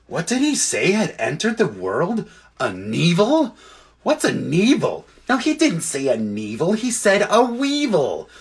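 high, swooping comic voices; puzzled animals. Acted. Creatures puzzling over a misheard word, comic high voices. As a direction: chatter in baffled questions and corrections.

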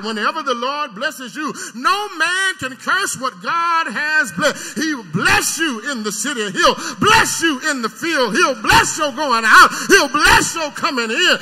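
loud, crying preacher's whoop; preaching at full force. Acted. Preacher to his church about bad bread, full-force crying shout. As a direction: pour it out over the congregation.

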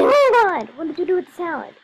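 high, loud alarmed shriek of surprise; loud, high mock panic over the salad; shocked cry. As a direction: gasp it out as if the kitchen is ruined. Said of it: "I was like".

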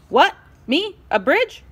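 high, loud, indignant questions; snapped. A narrator's reading. Ruler balks at being bridge, snapping. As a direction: fire back each word, affronted. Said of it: "Ruler snapped".

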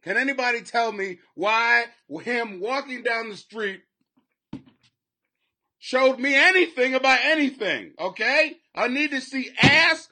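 loud, high-pitched demand for answers; exasperated rant. Acted. Viewer demands to see tattoos, exasperated loud rant. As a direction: rant at full pitch, baffled and fed up. Rants at the pointless footage.

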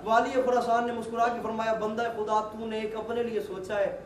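usual speaking voice

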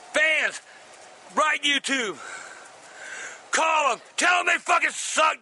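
ranting curses; ranting at YouTube, steam let off; angry rant. Real, not acted. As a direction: vent your fury at them. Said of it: "I'm goddamn mad".

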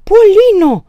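high, very loud shriek. A narrator's reading. Mother crying out to her daughter, shriek. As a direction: scream the name in alarm. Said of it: "țipă Aristița".

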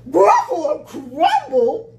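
loud, slow, high-rising exclamation; alarmed Gruffalo. Acted. Gruffalo hearing it may be eaten, loud alarm. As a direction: boom it back in horror.

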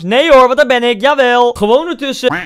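loud, high burst of glee; loud gleeful cheer at the replay; excited. Real, not acted. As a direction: cheer yourself on loudly. Excited commentary on own play.

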